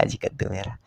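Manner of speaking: soft unvoiced whisper. Sick girl asking quietly, hushed whisper. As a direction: breathe the line out secretly. Girl talking about her illness.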